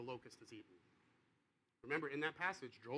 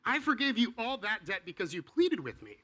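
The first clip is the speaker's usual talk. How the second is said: stern master; 3 semitones higher, 7 dB louder than usual. Acted. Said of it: "the master said".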